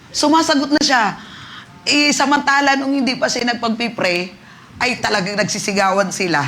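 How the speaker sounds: high, loud, thrilled retelling; excited testimony. Real, not acted. Pastor recounting the healing to church, thrilled and loud. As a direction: let the joy burst out.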